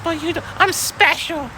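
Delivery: high, whiny put-on kid voice; student insisting she is special, mocked whiny; mock bratty student. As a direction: mimic a spoiled teen, squeaky. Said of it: "it's like".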